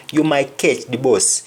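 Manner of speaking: Jamaican Patois, "bus" rounded to "boss". Shown as a demonstration. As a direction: give it the Jamaican "ketch di boss" sound. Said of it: "in Jamaican, when somebody says bus".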